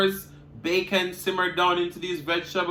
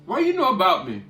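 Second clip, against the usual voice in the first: sassy retort; 6 semitones higher, 6 dB louder than usual. Retold or imitated. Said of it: "I'm like".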